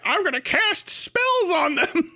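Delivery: laughing through a quote of him; giggling as you quote the spell line; mocking his threat through laughter. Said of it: "where he's like".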